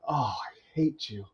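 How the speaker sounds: slow, soft, relished fan's hatred; mock loathing. Fans come up to the actor with a big smile, affectionate mock hate. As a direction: imitate a fan savouring the dislike, drawn out.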